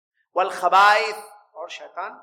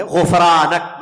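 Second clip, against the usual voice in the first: غفرانک drawn out very slowly and much louder; slow recitation; 13 dB louder, much slower than usual. Shown as a demonstration. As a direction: enunciate غفرانک syllable by syllable for the class. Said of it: "اس کو چاہیے کہ وہ کہے".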